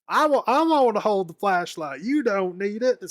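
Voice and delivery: hammed-up Southern impression; Franklin's Southern accent. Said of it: "doing the Southern voice for Franklin".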